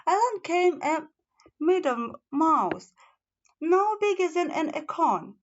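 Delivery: plain reading voice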